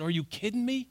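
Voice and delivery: a touch of incredulous surprise; a hint of exasperated reader; mild disbelief. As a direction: react to the passage with quiet disbelief. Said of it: "I said".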